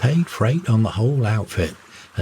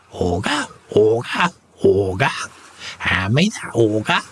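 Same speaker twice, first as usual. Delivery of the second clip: screaming parrot. A narrator's reading. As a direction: screech it like an excited parrot. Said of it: "dancing and screaming".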